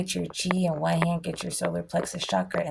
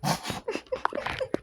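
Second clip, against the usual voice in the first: high breathy laugh; 5 dB quieter than usual. Real, not acted. Laughs at awkward story.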